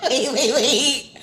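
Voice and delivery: slow, laughing protest; giggling plea to pause. Real, not acted. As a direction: talk through the laughter. Laughing at the book.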